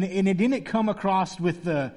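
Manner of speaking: ordinary delivery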